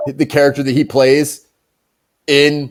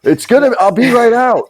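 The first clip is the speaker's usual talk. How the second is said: high-pitched call to someone outside; 8 semitones higher than usual. Acted. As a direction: call through the door, pitched up. Acts president calling out to aide.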